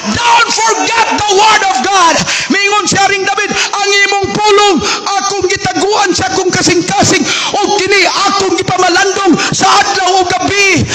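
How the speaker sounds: fervent pulpit reading; preaching at full force. Acted. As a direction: proclaim the scripture to the whole hall. Pastor reads scripture at full force.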